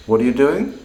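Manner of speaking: doing rises high; rising tone. Shown as a demonstration. Teacher comparing three ways to ask. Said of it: "all fundamentally mean essentially the same thing".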